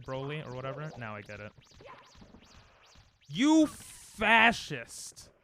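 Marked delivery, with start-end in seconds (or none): 3.3-5.3 very slow, stretched-out, loud insult; raging insult. Real, not acted. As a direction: drag each word out in high outrage. Raging at the game.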